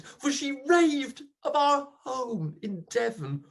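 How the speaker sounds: plain reading voice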